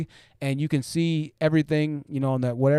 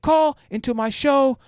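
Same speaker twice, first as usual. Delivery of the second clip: mock furious host; 9 semitones higher than usual. Streamer imitating the host banning women callers, hammed-up rage. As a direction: lay down the law like an outraged host. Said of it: "He's like".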